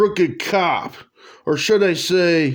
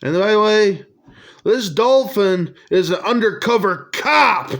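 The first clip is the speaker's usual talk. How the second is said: ordinary talk, nothing marked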